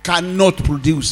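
emphatic preacher, stretching key words; forceful preaching. Real, not acted.

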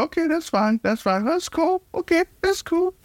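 very high-pitched, sing-song okay; high mock agreement. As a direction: squeak out a fake-cheerful that's fine. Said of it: "I said".

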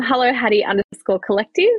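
usual speaking voice

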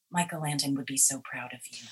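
low, soft murmur in the ear; leaned-in murmur. Retold or imitated. Co-star leaning in before the next take, soft murmur. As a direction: lean in and murmur it close. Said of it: "she leaned into me and said".

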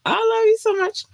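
squeaky high greeting to fans; gushing high-pitched love; high squeal. Real, not acted.

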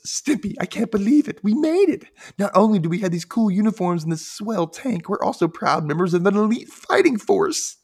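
high, excited cartoon voice; Ren voice. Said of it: "Ren says".

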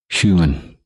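ordinary talk

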